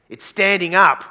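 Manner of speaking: loud, high, exasperated complaint; older guy. Acted. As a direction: blurt it out in loud disbelief. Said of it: "They go".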